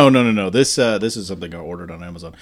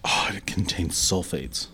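slow, unvoiced whisper; 4 semitones lower, 6 dB quieter, slower than usual. Real, not acted. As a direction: whisper it slowly. Whispered reading of label.

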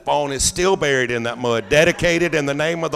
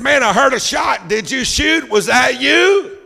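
shouted high and loud from a distance; hunting buddy yelling excitedly across the trees; 10 semitones higher, 7 dB louder than usual. Retold or imitated. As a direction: call out urgently across the woods. Hunting buddy hears a shot.

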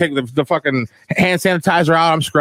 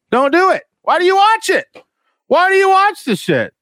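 exasperated rant. Real, not acted. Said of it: "ranting at viewers".

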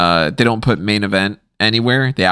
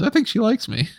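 imitated smitten wrestler; 12 semitones higher than usual. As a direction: quote him in a much higher, lighter register. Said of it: "he just goes".

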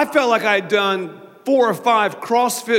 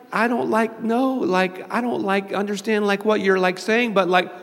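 higher, halting mumble stuffed with likes; inarticulate undertalker; 4 semitones higher than usual. Retold or imitated. Pastor imitating his tongue-tied interviewee, vague and halting. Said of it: "she'd be like".

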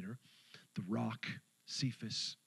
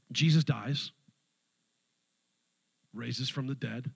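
usual speaking voice

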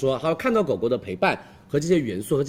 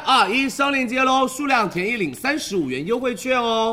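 hard sell ad; 8 semitones higher than usual. Acted. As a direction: shout the countdown like a sales host. Livestream countdown to open link.

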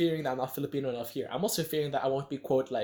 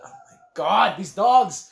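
loud, higher cry of annoyance; exasperated outburst; 6 semitones higher, 13 dB louder, much slower than usual. Real, not acted. Losing it at the dogs mid-thought, loud exasperated outburst. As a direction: burst out in real exasperation, drawn out. Interrupted by dogs while recording.

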